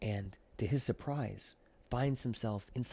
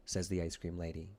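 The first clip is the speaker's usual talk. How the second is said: usual speaking voice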